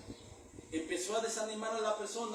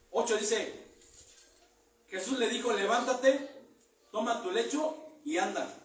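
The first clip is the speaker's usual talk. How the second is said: louder declaiming read of the verses; preaching scripture; 3 semitones higher, 7 dB louder than usual. Acted. Preacher reading the healing story aloud, louder declaiming. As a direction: proclaim the text to the hall.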